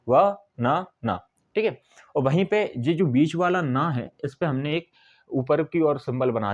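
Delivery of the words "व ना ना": "व, ना, ना" as three separate beats; syllable by syllable. Shown as a demonstration. Teacher pointing out three syllables, "व, ना, ना" said one by one. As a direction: pause between each syllable of "व, ना, ना". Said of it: "यहाँ पे तीन syllable हैं".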